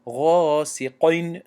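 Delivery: غاسقین with a rounded, heavy "qoyn" ending; over-heavy ق in غاسقین; wrong heavy qaf. Shown as a demonstration. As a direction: mispronounce غاسقین, thickening the ق before the i. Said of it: "نمیگیم".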